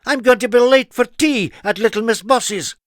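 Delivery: high, loud hurried exclamation; flustered cry. A narrator's reading. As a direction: make a quick excuse. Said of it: "he cried".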